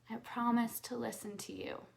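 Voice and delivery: whispered promise. Shown as a demonstration. Coach whispers promise to inner self, whispering. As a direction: breathe the promise without voice. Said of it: "repeated promise exercise".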